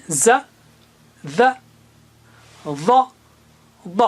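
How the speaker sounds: ز، ذ، ظ، ض each said alone with pauses; teacher reviewing four letters. Shown as a demonstration. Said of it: "تو یہ چار ہیں".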